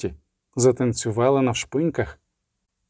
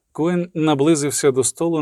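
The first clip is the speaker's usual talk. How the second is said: usual speaking voice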